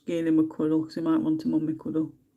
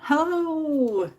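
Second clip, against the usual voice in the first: high sing-song greeting; 10 semitones higher than usual. Real, not acted. Greeting a Spanish viewer.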